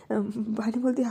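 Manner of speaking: usual speaking voice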